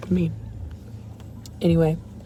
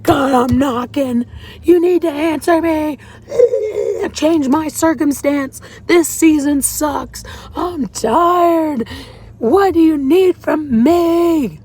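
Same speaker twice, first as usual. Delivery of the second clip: much higher, louder, slow pleading yell; desperate yell to God; 9 semitones higher, 3 dB louder, slower than usual. Retold or imitated. Demanding God answer her, high desperate yelling. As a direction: beg at the top of the voice. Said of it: "I yelled, like, I screamed".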